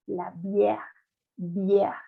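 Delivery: "bière" with a wide open E. Shown as a demonstration. Teacher models grave accent for class, "bière" with open E. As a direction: open the vowel in "la bière", bee-EHR. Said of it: "la vocal va a ser abierta".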